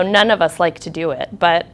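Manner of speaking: ordinary delivery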